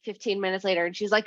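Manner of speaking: ordinary talk, nothing marked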